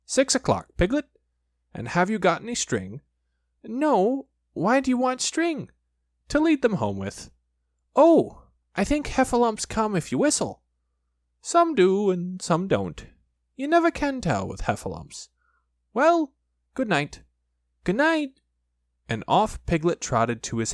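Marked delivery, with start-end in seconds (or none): 0.1-3.0 higher, lightly voiced bear; a gentle Pooh, a bit higher. Pooh's voice in story. 3.6-10.6 high, small piglet voice. Acted. Piglet's voice in story. 11.4-15.3 higher, lightly voiced bear; a gentle Pooh, a bit higher. Pooh's voice in story. 15.9-18.4 high, small piglet voice. Acted. Characters part.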